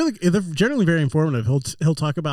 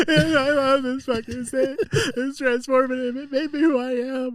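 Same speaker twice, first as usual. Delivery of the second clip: mock crying gush; 8 semitones higher than usual. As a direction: ham up a teary speech. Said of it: "[name]'s fucking crying".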